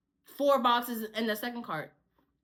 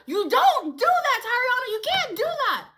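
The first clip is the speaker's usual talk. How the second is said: higher, louder mock scolding; scolding Big Show; 8 semitones higher, 4 dB louder than usual. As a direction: scold her like a worried friend. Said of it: "He was like".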